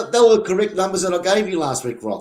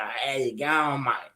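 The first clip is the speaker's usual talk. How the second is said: ocker Aussie; 6 dB quieter, much slower than usual. Retold or imitated. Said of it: "the old fair dinkum fucking Aussie yonkers".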